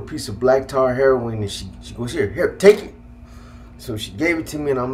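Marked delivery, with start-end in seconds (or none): 2.1-2.9 higher, louder imitation of fed-up giving in; retold frustration. Frustrated mom handing it to son, imitated exasperation. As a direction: thrust it at him, acted exasperation. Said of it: "she got so frustrated".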